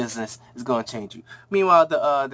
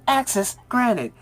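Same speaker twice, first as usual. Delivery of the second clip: slow, higher robotic scanner voice; machine voice; 7 semitones higher, slower than usual. Retold or imitated. Door scanner letting them in, robotic. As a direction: announce it like a security system.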